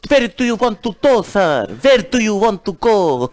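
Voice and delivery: hammed-up European cab driver; mock cabbie accent. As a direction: drag out the taxi driver's question. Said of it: "अब वो cab drive".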